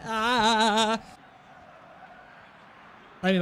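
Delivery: ordinary talk, nothing marked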